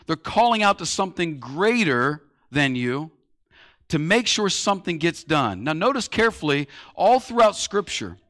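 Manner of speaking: usual speaking voice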